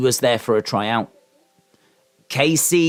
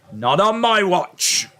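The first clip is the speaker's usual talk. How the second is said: slow, higher hammed-up declaration; mock heroic vow; 6 semitones higher, slower than usual. Shown as a demonstration. Vowing to the chat to protect it, hammed-up. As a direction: proclaim it like a mock hero.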